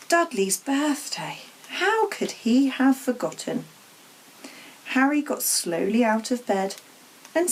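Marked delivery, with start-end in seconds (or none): none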